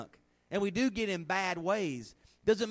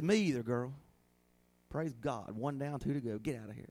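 joking retort; 6 semitones lower, 4 dB quieter than usual. Pretend.

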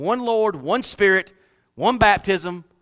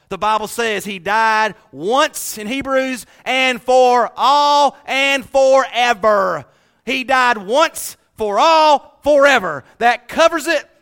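loud, higher, slow on for all and forever; preaching at full force; 7 semitones higher, 8 dB louder, much slower than usual. Acted. Pastor preaching Christ died for all, loud and drawn out. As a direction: drive the point home.

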